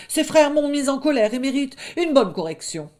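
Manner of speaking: high, loud bravado; lightly voiced blustering young wolf; bluffing wolf cub. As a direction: pretend you are in charge.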